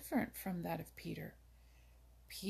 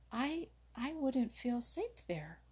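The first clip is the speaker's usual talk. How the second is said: timid; 6 semitones higher, slower than usual. A narrator's reading. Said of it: "replied Jumper rather timidly".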